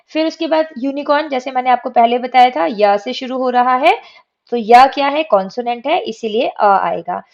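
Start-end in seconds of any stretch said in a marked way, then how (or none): none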